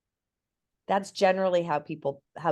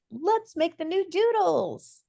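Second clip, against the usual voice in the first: excited friends; 11 semitones higher, slower than usual. Retold or imitated. Quoting eager friends.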